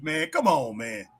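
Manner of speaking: normal talking voice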